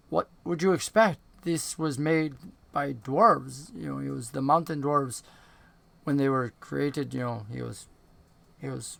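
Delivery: put-on soft, low-key old goblin voice; shadowy dark goblin. Shadowy goblin to a fellow prisoner about the ship's dwarven makers, quiet and measured. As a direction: speak quietly, as if explaining old history.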